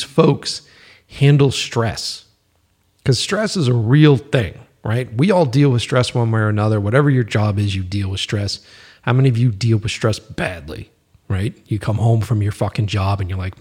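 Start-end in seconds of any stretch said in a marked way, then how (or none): none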